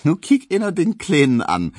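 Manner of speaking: high, loud, amused Berlin exclamation; half-pitying, half-smiling call. A narrator's reading. Hedwig to her clinging suitor, half pitying, half amused. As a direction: call out teasingly, with a smile in it. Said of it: "rief Hedwig halb mitleidig, halb lächelnd".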